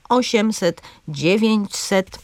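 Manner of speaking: „osiemset, dziewięćset” z akcentem na O i DZIE; akcent na trzecią sylabę. Shown as a demonstration. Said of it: "z akcentem na trzecią sylabę". (wzorcowe przykłady „osiemset, dziewięćset”, pierwsza sylaba mocna)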